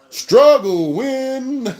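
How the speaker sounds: very slow, high, stretched call; drawn-out proclamation. Real, not acted. Fan summing up a narrow win, long drawn-out call. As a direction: stretch both words out high.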